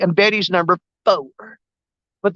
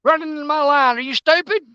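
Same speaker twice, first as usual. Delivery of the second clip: shooing a bird; 6 semitones higher than usual. Real, not acted. Shooing bird off fishing line.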